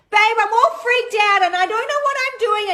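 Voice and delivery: high, loud, panicked wail down the phone; hammed-up freak-out, voice shooting way up; frantic high mock panic to her husband. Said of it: "this is what it would look like".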